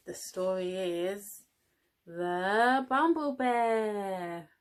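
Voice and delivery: very slow, drawn-out announcer voice; dramatic title reveal. Acted. Teacher unveiling the book title, slow and grand. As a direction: stretch the title out for suspense.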